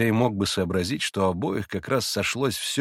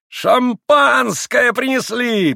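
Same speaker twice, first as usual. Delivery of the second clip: much higher, louder, slow shout; 14 semitones higher, 8 dB louder, much slower than usual. A narrator's reading. Rakitin announces the champagne with a loud shout. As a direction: cry it out gleefully. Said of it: "прокричал Ракитин".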